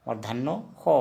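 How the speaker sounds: मूर्धन्य खो ending on a clear kh; kh not s. Shown as a demonstration. Teacher corrects सो to खो for learners. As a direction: stress खो as kho. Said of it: "उच्चारण होगा खो".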